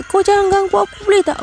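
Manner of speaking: high-pitched appeal to be left alone; pleading, pitched well up; retold pleading challenge. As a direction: act out a nervous confrontation. Retold confronting of the unseen tormentor.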